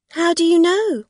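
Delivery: high, loud challenge; shouting. Acted. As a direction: throw the question back. Rosa challenging.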